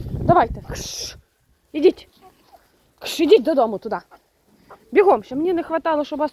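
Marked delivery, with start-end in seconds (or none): 1.7-6.3 high, loud commands to the birds; loud shooing. Real, not acted. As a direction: chase them home, scolding. Shooing chickens home. (гучно і сварливо жене курей додому)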